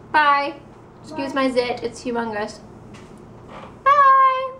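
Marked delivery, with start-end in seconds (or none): 3.8-4.5 loud goodbye. Real, not acted. Vlog sign-off.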